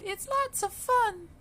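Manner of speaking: very high squeal of fun; a baby duck loving the water; delighted duckling. A narrator's reading. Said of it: "she cried".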